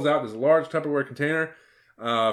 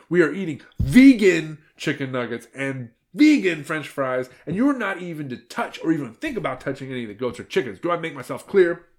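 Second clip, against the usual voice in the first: raised, emphatic telling-off; scolding; 6 semitones higher, 7 dB louder than usual. A narrator's reading. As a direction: lay down the law, punching key words. Red lays down the law.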